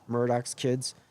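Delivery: normal talking voice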